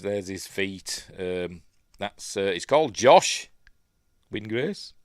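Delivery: usual speaking voice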